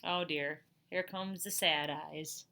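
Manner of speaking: ordinary delivery, nothing marked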